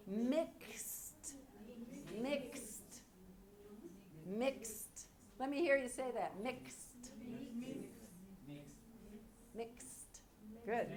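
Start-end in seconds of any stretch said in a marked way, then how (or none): 0.1-5.1 "Mixed" with k-s-t carefully articulated; model pronunciation. Shown as a demonstration. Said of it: "this is the pronunciation". 6.4-10.2 soft "Mixed", crisp k-s-t ending; all consonants pronounced. Shown as a demonstration. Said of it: "we pronounce all of those".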